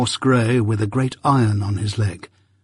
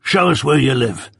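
gruff convict; 2 semitones higher than usual. Acted. Convict demands.

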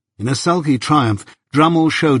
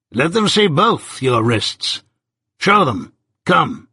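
higher-pitched, deliberate order, slow at first; insistent command; 4 semitones higher, slower than usual. A narrator's reading. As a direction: order her slowly, then curtly press her. Jaggers orders reluctant Molly.

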